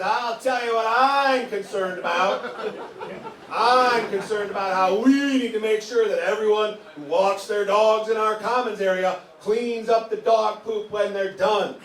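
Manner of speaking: a mock-earnest busybody, high and slow; pumped-up lady. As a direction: play the eager HOA lady who finally gets the floor, pitched high and drawn out. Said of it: "she's pumped".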